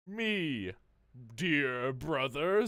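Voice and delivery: slow, drawn-out villain purr; villain reveal. Acted. Liquid revealing himself to his brother, slow and sinister. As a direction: savor the reveal to your brother.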